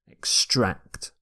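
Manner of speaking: EXTRACT stressed ex-TRACT; second-syllable stress. Shown as a demonstration. Teacher repeating the verb, EXTRACT with second-syllable stress. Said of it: "stress the second syllable".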